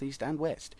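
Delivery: ordinary delivery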